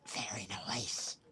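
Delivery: slow, whispered savouring; playful whisper. Acted. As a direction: draw it out in a mock-secretive whisper. Repeats it in hushed put-on voice.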